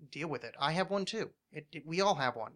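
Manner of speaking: normal talking voice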